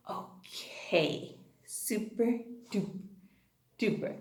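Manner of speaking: soft whispered praise. Acted. Teacher whispers praise to her little students.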